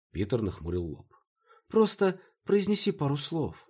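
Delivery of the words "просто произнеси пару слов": high-pitched begging; pleading. A narrator's reading. Maya asking Peter for some Greek words, pleading. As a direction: beg him in a much higher voice. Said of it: "взмолилась я".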